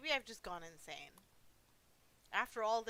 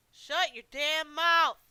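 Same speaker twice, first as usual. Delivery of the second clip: loud, higher, slow, deliberate; slow, loud, higher threat; furious roar; 7 semitones higher, 15 dB louder, slower than usual. Acted. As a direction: spit each word out. Husband roars, slow.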